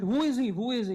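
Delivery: high, loud, eager questioning; retelling an excited village priest's loud question. Retold or imitated. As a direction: ask like an old man bursting with excitement. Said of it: "jumping and talking to them very excitedly".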